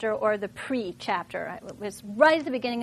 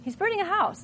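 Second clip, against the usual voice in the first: higher, dawning shock on burning; realizing; 8 semitones higher than usual. Shown as a demonstration. Teacher realizing what the character does, surprised. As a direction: let it hit you that he's burning a house.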